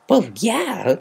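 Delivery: high, loud, smug yeah; proud smug yeah; preening. A narrator's reading. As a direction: show off a little. Rainbow preening.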